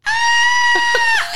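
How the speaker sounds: imitated very high, piercing squeal; loud toddler squeal. Mom imitating her toddler on the potty, loud high squeal. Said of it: "She was squealing so loud".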